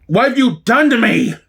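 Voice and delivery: sharp, high demanding question. A narrator's reading. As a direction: demand an answer from her. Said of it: "he demanded".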